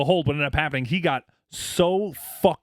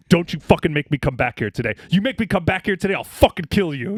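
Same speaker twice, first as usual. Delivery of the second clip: retold angry warning, louder; forceful, raised-voice mock threat; in-your-face threat; 4 semitones higher, 5 dB louder than usual. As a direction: threaten him hard, reenacting the confrontation. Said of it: "get in his face".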